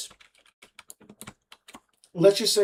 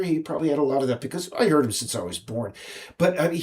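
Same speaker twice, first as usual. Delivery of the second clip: ordinary talk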